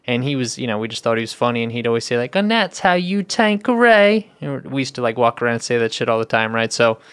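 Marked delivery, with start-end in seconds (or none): 2.3-4.3 higher, drawn-out ad-spokesman catchphrase; British spokesman impression. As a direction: imitate a British pitchman, stretching the words slowly. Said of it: "He was a British guy".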